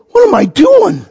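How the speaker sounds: very high alarmed shout; sudden panic mid-step; panicked Peter. Retold or imitated. Peter panics walking on water.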